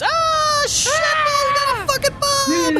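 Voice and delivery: very high mock crowd scream. The whole mob screams at once, high hammed-up scream. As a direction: scream it out at the top of your range. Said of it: "Everybody screams".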